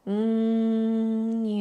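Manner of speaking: steady bee-like hum; humming. Shown as a demonstration. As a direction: hum with lips closed. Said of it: "While humming".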